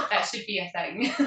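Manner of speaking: high, giggling through the words; laughing. Real, not acted. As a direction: laugh while you say it. Laughing at a joke.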